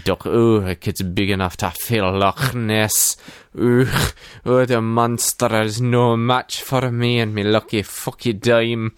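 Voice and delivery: put-on boastful Scottish-accented pep talk; Scrooge. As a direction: pump yourself up in a broad Scottish brogue. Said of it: "Scrooge is psyching himself up in the mirror".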